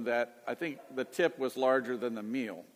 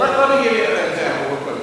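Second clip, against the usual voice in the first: louder over the others; 4 semitones higher, 11 dB louder, faster than usual. Real, not acted. Talking over another group member.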